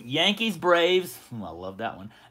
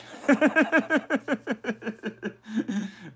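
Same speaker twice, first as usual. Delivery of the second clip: laughing; 6 semitones higher than usual. Real, not acted. Laughs at his crazy pick.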